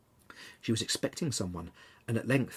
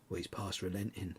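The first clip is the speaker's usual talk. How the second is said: quiet; 4 semitones lower, 10 dB quieter than usual. A narrator's reading. Husband tells of death.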